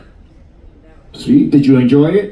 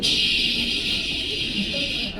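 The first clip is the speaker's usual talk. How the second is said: shower water shush; 13 dB quieter than usual. Retold or imitated. Imitating shower water sound.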